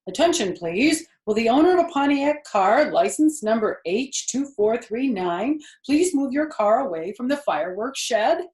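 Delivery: loud announcer call; loudspeaker announcement. Acted. A fair loudspeaker calling a car owner, booming announcement. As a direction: boom it out like a fairground loudspeaker. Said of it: "An enormous voice over the loudspeaker said".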